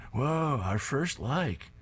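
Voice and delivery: excited. Acted. Teen sees first like on phone.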